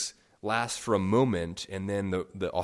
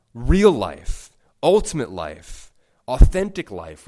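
emphatic list; 9 dB louder than usual. Real, not acted. Preacher building list.

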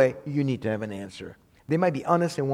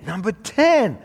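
TEN pitched high and loud; loud announcement; 7 semitones higher, 7 dB louder, slower than usual. Real, not acted. Preacher announcing number ten to the congregation, loudly. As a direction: call it out louder and higher. Repeats the point number emphatically.

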